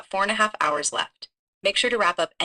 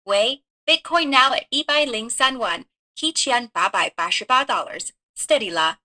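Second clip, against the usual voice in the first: English in a put-on Chinese accent; Chinese-accented English with Mandarin numbers; 3 semitones higher than usual. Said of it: "Can you speak in a Chinese accent?".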